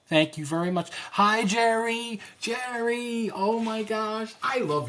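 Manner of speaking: high, loud, drawn-out hello to a fan; light up as a familiar viewer joins; excited greeting. Real, not acted. Host welcoming Jerry into the livestream, thrilled.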